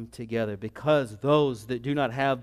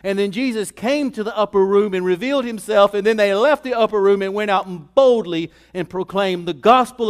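loud, raised sermon delivery; full-force preaching; 5 semitones higher, 7 dB louder than usual. Real, not acted. Preacher tells congregation of the risen Christ, full-force preaching. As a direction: proclaim it boldly to the congregation.

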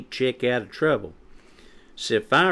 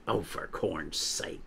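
low, soft grumble; peeped exasperation; 4 semitones lower, 9 dB quieter than usual. A narrator's reading. Chick fed up with Quinny's demand, low exasperated grumble. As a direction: grumble it under your voice, exasperated. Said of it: "peeped Chick".

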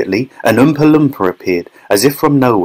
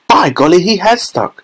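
higher, startled cry; surprised exclamation; 8 semitones higher than usual. A narrator's reading. Charlie exclaiming at the stuck boy, surprised. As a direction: exclaim it in amazement.

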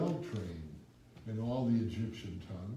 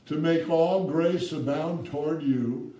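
loud, slow, raised proclamation; ringing pulpit scripture; preaching at full force; 5 semitones higher, 8 dB louder, slower than usual. Acted. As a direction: stretch each phrase like a promise.

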